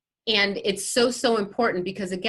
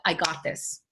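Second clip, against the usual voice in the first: softer, lower reassuring self-talk; quiet confident affirmation; calm self-talk; 4 semitones lower, 5 dB quieter, faster than usual. Shown as a demonstration. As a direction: talk yourself down gently.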